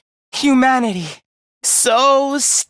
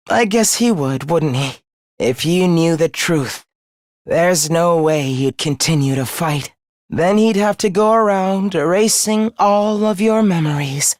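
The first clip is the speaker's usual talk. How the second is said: low, subdued bitterness; weary scorn; 7 semitones lower than usual. Acted. Defeated villain doubting what foes were told, low weary scorn. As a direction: sink low, dismissing their leader.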